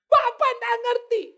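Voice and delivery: high, slow, loud complaint; wailing wife. Acted. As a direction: moan it at the pastor. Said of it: "Satu orang telepon saya". (istri mengeluh keras dan tinggi)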